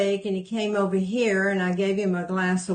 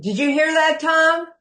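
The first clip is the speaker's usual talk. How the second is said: higher and louder, shouting across the house; calling out; 7 semitones higher, 5 dB louder than usual. Real, not acted. Wife calling to Tom in the other room, shouting. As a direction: call to Tom in the next room.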